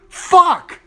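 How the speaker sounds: high, loud burst of exasperation; one sharp furious curse; frustrated yell. Real, not acted. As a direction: explode in disbelief. Exasperated outburst at Anna's claim.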